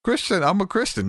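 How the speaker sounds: a mock frantic plea from the floor; panicked protest. Friend protests while being prayed over.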